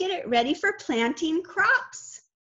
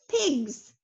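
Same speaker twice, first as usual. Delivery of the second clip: ordinary talk, nothing marked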